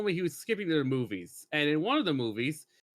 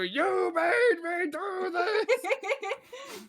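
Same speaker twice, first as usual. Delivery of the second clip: high, slow laughing sob; hammed-up crazed cry; much slower than usual. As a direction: wail it like a deranged villain. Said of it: "like, just go".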